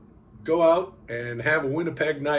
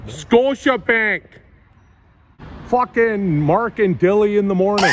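put-on Canadian accent; 5 semitones higher, 3 dB louder than usual. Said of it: "reading signs in my Canadian accent".

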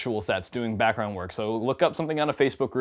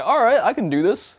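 higher and louder, eagerly agreeing; a pumped-up yes to the camera guy; game for it; 11 semitones higher, 7 dB louder than usual. Retold or imitated. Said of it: "I'm like".

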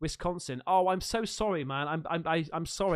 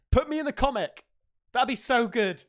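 loud eager plea; 5 semitones higher, 7 dB louder than usual. Real, not acted. Pleading to be in comic.

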